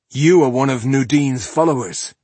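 hard, loud accusing line; challenging accusation. Acted. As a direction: throw the accusation at him. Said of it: "he challenged the man".

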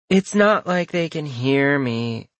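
slow lazy drawl. Acted. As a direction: drag the words out lazily. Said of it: "Junior drawled".

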